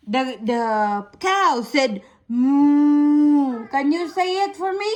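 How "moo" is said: long drawn, louder moo; mooing cow. Shown as a demonstration. Mother mooing like a cow for her toddler. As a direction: sing out a big moo. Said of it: "the sound of a cow".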